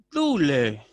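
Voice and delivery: "dule" loud, tone dropping into a long final syllable; tonal descent. Shown as a demonstration. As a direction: start "dule" strong and let the pitch tumble down. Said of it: "se produce un descenso tonal". ("dule" con descenso tonal e intensidad marcada)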